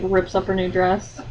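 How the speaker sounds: ordinary delivery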